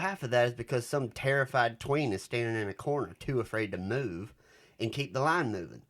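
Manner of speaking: put-on Southern accent, loud and showy. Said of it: "he has a really thick Southern accent".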